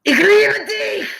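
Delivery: high, loud and slow; hammed-up German shout of love; yelled love line. Shown as a demonstration. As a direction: shout a tender line like an order. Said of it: "more fun when you're yelling it".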